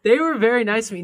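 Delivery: amused insistence. Real, not acted. Insists after friend's jeer.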